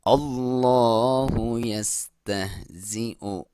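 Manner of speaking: slow, drawn-out tajweed chant; slow Quran recitation. Shown as a demonstration. Teacher reciting the verse for students, slow and drawn out. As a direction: recite it slowly, stretching each vowel. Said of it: "लाम जो है वो मोटा पढ़ेंगे".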